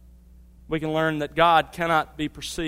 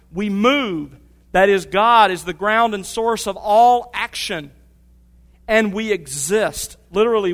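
booming preacher building conviction; full-force preaching; 3 semitones higher, 6 dB louder than usual. Acted. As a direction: drive the point home to the congregation.

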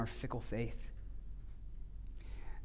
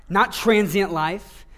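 preaching the contrast, building louder; rising emphatic preaching; 9 semitones higher, 15 dB louder than usual. Acted. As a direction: hammer each not harder.

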